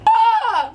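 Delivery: high, loud "ah" of despair; hammed-up tearful cry; mock panicked wail.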